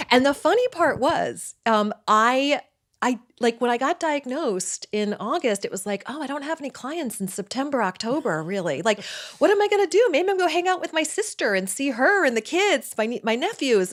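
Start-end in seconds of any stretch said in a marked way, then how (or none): 6.1-13.9 high, fast racing thoughts; thinking out loud in a flurry; a burst of anxious chatter; excited. Retold or imitated. Inner thought after diagnosis.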